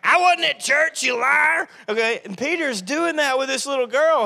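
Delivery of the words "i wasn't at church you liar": high, loud, mock denial; mock indignant yell. Pastor playing himself denying church to a girl, mock yell. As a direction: bluster it like a kid caught lying. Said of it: "I was like".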